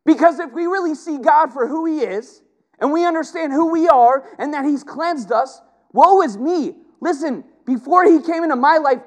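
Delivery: high, loud preacher's cry; fiery pulpit climax; impassioned preaching. Acted. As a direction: preach it at full force, voice up high. Preaching at full force.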